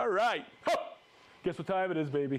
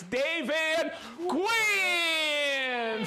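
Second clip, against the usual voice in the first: hype announcer call; 5 semitones higher, much slower than usual. Real, not acted. Big intro for favourite viewers.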